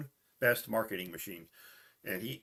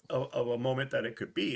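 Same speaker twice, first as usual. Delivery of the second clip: ordinary delivery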